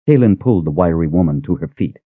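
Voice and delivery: ordinary delivery, nothing marked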